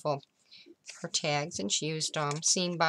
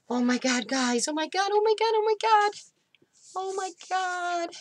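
shrieking jackpot winner. Real, not acted. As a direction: shriek with disbelieving joy. Shrieking over a surprise gift.

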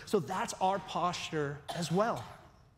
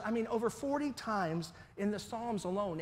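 ordinary delivery

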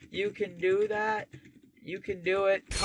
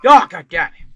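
frustrated outburst; 15 dB louder than usual. Real, not acted. Frustrated at game.

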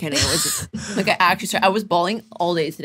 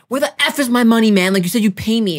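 raised-voice imitation of an irate caller; retold angry confrontation; 4 semitones higher, 6 dB louder than usual. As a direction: act out demanding your money back, loudly. Acting the caller demanding money.

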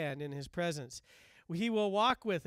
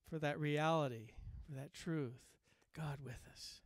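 hushed; 6 semitones lower, 10 dB quieter than usual. Real, not acted. Preacher's hushed climax to listeners.

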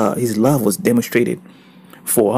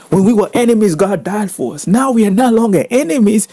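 excited preaching; 8 semitones higher, 3 dB louder than usual. Real, not acted. Preacher exclaiming the good news.

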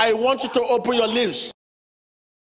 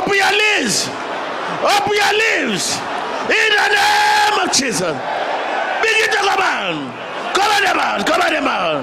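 shouting faith healer; 7 semitones higher than usual. Acted. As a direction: urge them on at full force. Preaching at full force.

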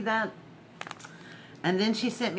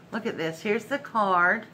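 normal talking voice

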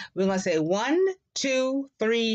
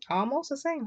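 ordinary talk, nothing marked

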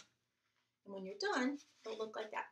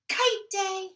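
joyful shout, higher and louder; 6 semitones higher, 11 dB louder than usual. Acted. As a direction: call it out with delight. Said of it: "and then he shouted".

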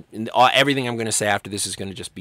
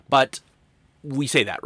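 usual speaking voice

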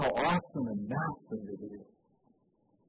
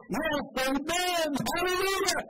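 high, drawn-out amen then hallelujah; exultant praise; 10 semitones higher than usual. Acted. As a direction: lift it up in worship. Elders' worship acted out.

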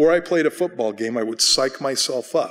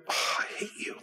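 whispered, sighing mock grumble; mock exasperation; 10 dB quieter than usual. Exasperated Mitch to his unexcitable friend, sighed whisper. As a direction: sigh it out under your breath in mock despair. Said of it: "he'll go".

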